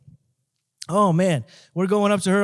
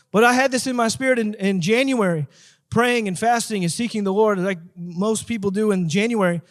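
usual speaking voice

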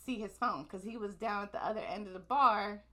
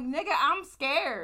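slow, louder, high mock panic; mock scared; 5 semitones higher, 8 dB louder, slower than usual. Telling him she's too scared to floor the Lambo, mock panic. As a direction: drag it out like a hammed-up scared passenger. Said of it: "I was afraid".

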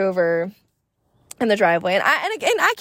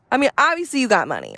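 ordinary talk

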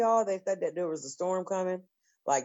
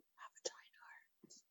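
barely audible whisper; whispered confession; 26 dB quieter than usual. Real, not acted. Confiding why she sits on pillows, whispering. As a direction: drop right down to a secretive whisper.

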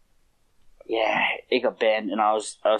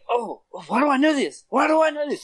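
high, puzzled whine; mock frustrated wondering; retold puzzlement; frustrated puzzling; 12 semitones higher than usual. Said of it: "and I was like".

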